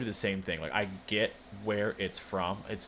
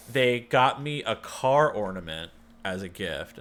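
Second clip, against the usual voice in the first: ordinary talk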